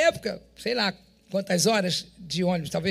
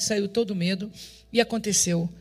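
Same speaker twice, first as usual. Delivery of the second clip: ordinary delivery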